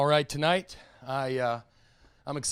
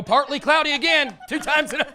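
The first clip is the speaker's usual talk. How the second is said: higher, louder forecast-reader voice; mock weatherman; 8 semitones higher, 4 dB louder than usual. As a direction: ham up a TV weatherman. Mocking a weatherman reading forecast.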